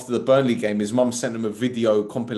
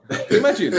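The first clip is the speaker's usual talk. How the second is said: ordinary talk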